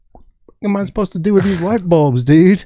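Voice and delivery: exasperated question, pushed up high and loud; retold exasperated protest. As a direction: protest to the cop in mock helplessness.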